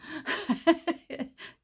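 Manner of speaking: high-pitched burst of laughter; real, tickled laughing; amused laugh. Real, not acted. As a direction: laugh at your own fond memory. Amused by her own memory.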